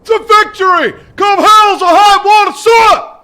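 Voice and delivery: all-out shout ending on sir; full shout. Real, not acted. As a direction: roar it back at the drill sergeant. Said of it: "Sound off like you got a pass".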